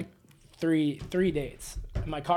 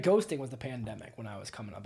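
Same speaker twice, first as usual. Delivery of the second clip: ordinary delivery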